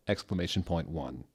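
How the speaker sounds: usual speaking voice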